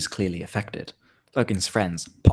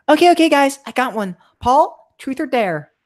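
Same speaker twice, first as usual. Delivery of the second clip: high, loud put-on party voice; friend hosting the game; 7 dB louder than usual. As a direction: pitch it way up and call the next player. Cal's line in acted game.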